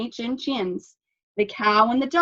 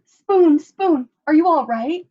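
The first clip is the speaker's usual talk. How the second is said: loud, high anxious calls; worried call; 8 semitones higher, 8 dB louder than usual. A narrator's reading. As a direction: call out in worry. Cat rushes to Spoon.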